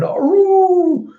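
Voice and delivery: high, sustained howling cry; loud mock howl, held on one note; imitated howl. Imitates a howl heard from above.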